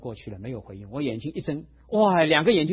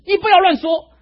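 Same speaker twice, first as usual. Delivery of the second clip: shouted much higher and louder, slamming the table; retold angry shout; 10 semitones higher, 8 dB louder than usual. 老人在讲道中拍案而起，对牧师大声怒喝. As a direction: bark it out like an old man jumping up in rage. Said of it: "突然有人拍案而起".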